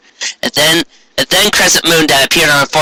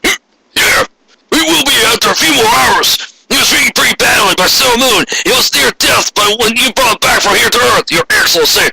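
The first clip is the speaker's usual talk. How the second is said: high, hurried henchman report; servant voice; 9 semitones higher than usual. Acted. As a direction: report nervously to the mistress.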